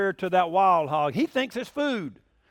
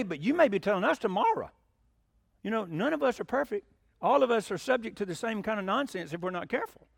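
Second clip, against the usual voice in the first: usual speaking voice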